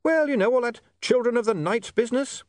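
high, urgent yell; shouting over noise. Acted. William prompts Otto amid barking, urgent shout. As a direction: yell the suggestion over the din.

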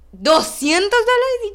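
loud, high, drawn-out outcry; shocked disbelief. Retold or imitated. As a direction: blurt it out high and slow, aghast at the price. Reacción atónita al precio del casco. (incredulidad escandalizada)

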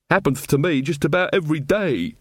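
mild glum grumble from a hard-done-by villager; gloomy. A narrator's reading. Weaver grumbling about home life to drinking pals, mildly gloomy. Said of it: "said Weaver gloomily".

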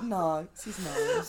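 cracking up mid-sentence; squealing with laughter; laughing. Real, not acted. Friends cracking up at joke.

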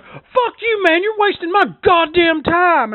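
high, loud mock outburst; hammed-up frustrated yell; mock angry scammer. Scammer losing his temper on the phone, mock yell.

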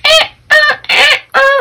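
high squeaky rubbing noise, eh ah; balloon squeak imitation. Sister rubbing a balloon, imitated squeaky noise. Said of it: "when you rub a balloon and it's like".

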